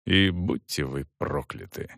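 low, soft muttered curse; quiet aside. Acted. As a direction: say it quietly under the breath. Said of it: "тихо добавил". (тихо, вполголоса)

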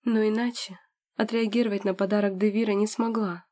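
ordinary delivery, nothing marked

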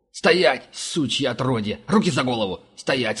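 angry shout. A narrator's reading. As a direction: yell the order at gunpoint. Guard aims at intruder.

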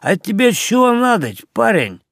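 put-on drunk watchman. A narrator's reading.

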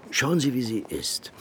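confiding whisper aside; hushed, confidential whisper. Acted. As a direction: lean in and whisper it. Confiding aside about Nina.

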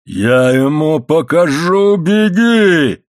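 slow roar climbing to a high shout; crazed yell. A narrator's reading. Enraged father bellowing at his son, crazed yell. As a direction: scream it like a madman, building. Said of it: "как сумасшедший заорал он".

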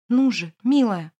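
loud, slow desperate plea; urgent pleading. Acted. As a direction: beg her to wake up. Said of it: "молит Эмир".

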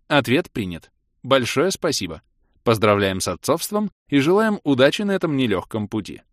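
размеренный официальный голос автоинформатора, чуть приподнятый; объявляй как игровая система; системное объявление. Acted. (официальное системное сообщение)

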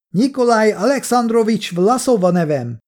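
high-pitched shouted introduction; calling out. A narrator's reading. Kolja gives his name toward the window, calling out. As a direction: project it toward someone outside. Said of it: "kiáltotta az ablak felé".

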